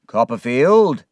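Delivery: loud, stern summons by surname; stern, accusing call; angry. Acted. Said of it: "His face was angry".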